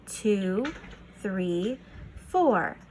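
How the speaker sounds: ordinary talk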